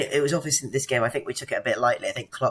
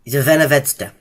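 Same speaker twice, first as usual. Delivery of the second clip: louder, put-on Serbian accent; 3 semitones higher, 6 dB louder than usual. Shown as a demonstration. Co-host showing how to do it better, Serbian accent. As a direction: say it boldly like a Serbian. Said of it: "you did it in a Serbian accent".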